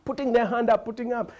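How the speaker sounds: normal talking voice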